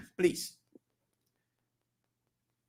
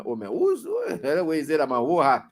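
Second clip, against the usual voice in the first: usual speaking voice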